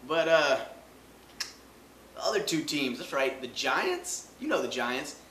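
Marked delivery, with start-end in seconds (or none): none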